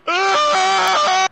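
high, loud scream of fright; terrified scream. Real, not acted. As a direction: shriek in panic. Scream in game fight.

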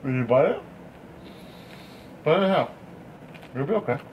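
ordinary talk, nothing marked

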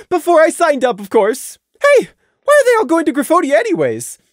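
cheery, rambling small talk; bright Taffy. A narrator's reading. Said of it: "Taffy inquired brightly".

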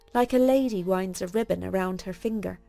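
ordinary delivery

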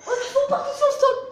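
high laughing squeal. Real, not acted. Laughing at the mess.

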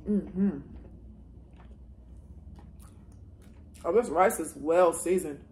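chewing while talking; speaking between bites; mouth full. Real, not acted. Seen 5: Eats forkfuls of rice and meat, mouth working throughout labelled words.

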